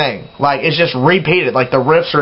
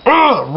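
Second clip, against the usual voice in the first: high, frustrated "ugh"; mad groan; 8 semitones higher than usual. Real, not acted. Fan mad about yelling over riffs, frustrated groan. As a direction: let the annoyance out as a groan. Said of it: "really makes me mad".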